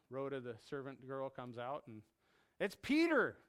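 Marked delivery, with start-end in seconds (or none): 2.6-3.4 higher, thrilled announcement; excited cry. Acted. Servant girl finding Peter at the door, excited cry. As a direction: blurt out the good news. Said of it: "in their excitement".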